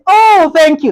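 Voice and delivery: very high, loud, sugary fake thank you; fake polite thanks. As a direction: gush out the polite lie while starving. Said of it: "Now lie".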